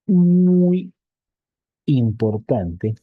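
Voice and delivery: teacher drilling the point home; emphatic muy. Real, not acted. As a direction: hit muy hard, then draw out importante. Teacher stressing key point.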